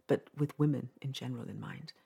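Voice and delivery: ordinary delivery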